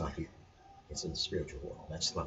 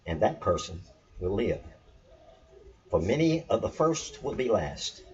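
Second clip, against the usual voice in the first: raised, loud, ringing recitation of scripture; scripture reading; 4 semitones higher, 6 dB louder than usual. Acted. Teacher reciting a gospel saying to viewers, loud and lifted. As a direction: declaim the saying like holy text.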